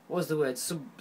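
normal talking voice

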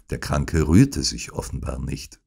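ordinary delivery, nothing marked

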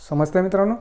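ordinary talk, nothing marked

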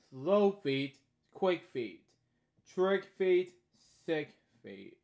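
lively sing-song read-aloud; storybook reading. Acted. Reading a bedtime story aloud, lively. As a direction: read each line brightly to a child.

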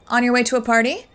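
ordinary talk

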